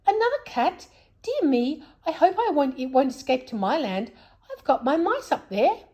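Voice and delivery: put-on high, fretful old man voice; worried Saucepan Man. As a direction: fuss anxiously about cats, high.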